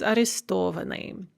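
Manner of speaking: арестованы stressed on the syllable сто; shifted stress. Shown as a demonstration. Teacher reviewing the stress shift, сто stressed in арестованы. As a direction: move the stress of арестованы back onto сто. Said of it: "shift one syllable to the front".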